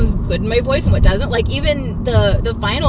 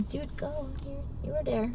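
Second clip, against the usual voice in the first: growling; 3 semitones higher, 16 dB quieter than usual. Retold or imitated. Friend imitating the singer growling angrily. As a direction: growl the line under your breath.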